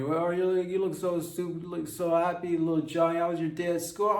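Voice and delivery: happy mother fussing over her son; chirpy mom. Acted. Mom Beth greets son.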